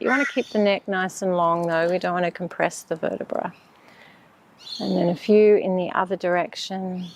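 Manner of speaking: ordinary talk, nothing marked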